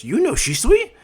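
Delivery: loud, very high outburst; astonished question. Retold or imitated. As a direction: ask it as if you cannot believe it. Both boys astonished.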